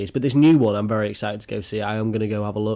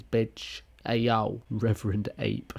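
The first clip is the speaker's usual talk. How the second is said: ordinary talk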